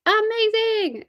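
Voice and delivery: high bright amazing; excited praise. Real, not acted. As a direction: burst out with delight. Cheering a viewer.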